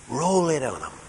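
ordinary talk

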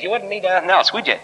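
high-pitched Scottish imitation; little Scottish voice. Clergyman correcting a young preacher, little Scottish voice. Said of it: "in his little Scottish voice".

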